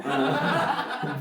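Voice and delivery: slow evil Bond villain chuckle; menacing mock villain laugh. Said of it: "ce rire de méchant de James Bond".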